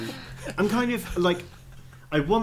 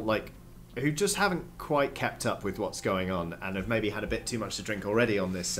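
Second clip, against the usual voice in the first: usual speaking voice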